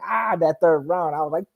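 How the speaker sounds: ordinary delivery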